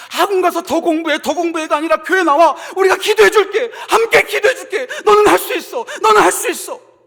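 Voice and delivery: high, loud rallying cry; impassioned urging. Acted. Church to exam students, impassioned shouted encouragement. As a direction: cheer them on at full voice.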